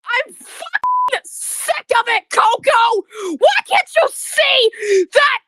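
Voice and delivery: shouting through tears; crying shout. Real, not acted. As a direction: yell it while sobbing. Breaking down at the bully.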